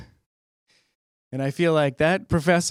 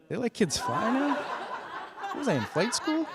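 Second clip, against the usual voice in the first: high, laughing disbelief; confused student laughing at the absurdity; bewildered laugh; 10 semitones higher, 5 dB quieter than usual. Retold or imitated. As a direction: ask it giggling in disbelief.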